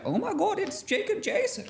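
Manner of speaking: very high mock-royal gush; hammed-up falsetto monarch, delighted; the Queen. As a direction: squeal it as a comic queen. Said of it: "And the Queen was like".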